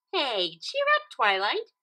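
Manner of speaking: high, smiling pony voice; high pony voice cheering up a glum friend; cheerful Pinkie. A narrator's reading. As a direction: cheer her up brightly. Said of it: "Pinkie Pie said with a smile".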